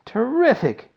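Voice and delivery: high, loud delighted exclamation; excited cry. A narrator's reading. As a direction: burst out with joy. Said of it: "cried Grandpa Joe".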